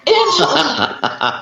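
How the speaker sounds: slow, stretched mock warning call of Angela's name; drawn-out scolding name. Teasing a friend who only watches, slowly drawn-out name. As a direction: drag the name out like a fond scold. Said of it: "And I'm like".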